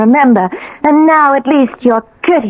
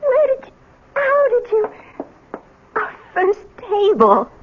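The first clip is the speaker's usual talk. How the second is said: higher, softer, near tears with wonder; tearful awe; 8 semitones higher, 4 dB quieter than usual. Acted. Wife finding her old apartment intact, tearful and awed. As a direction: voice catching as the memories flood back.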